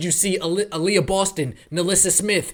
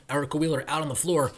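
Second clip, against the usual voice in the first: normal talking voice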